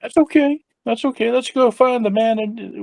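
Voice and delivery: high-pitched easy reassurance; placating. Acted. Visitor to a scared underling with a pistol, placating. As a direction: talk a nervous man down in a high voice. Player placates nervous gunman.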